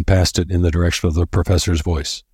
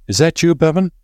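worried call; 11 semitones higher, 3 dB louder than usual. A narrator's reading. Gold calling through the dark.

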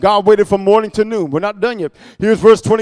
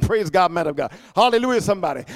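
ordinary delivery

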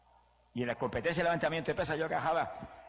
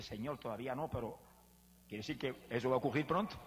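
much softer, awed murmur back to God; hushed reply; 2 semitones lower, 7 dB quieter than usual. Retold or imitated. The man quietly answering God's voice, hushed and awed. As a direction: answer softly, stunned and humble. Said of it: "Pero yo dije".

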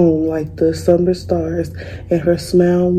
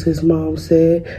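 plain reading voice

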